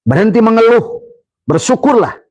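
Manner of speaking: high, ringing call to stop complaining; fervent high preaching cry; preaching exhortation. Real, not acted. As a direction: lift the voice and command them.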